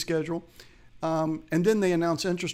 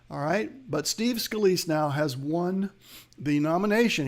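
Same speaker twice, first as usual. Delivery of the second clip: ordinary delivery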